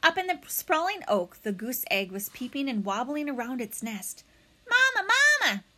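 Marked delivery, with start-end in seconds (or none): 4.7-5.6 tiny chirpy egg, much higher and louder; little egg's voice. Acted. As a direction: squeak it out like a baby gosling. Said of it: "said the egg".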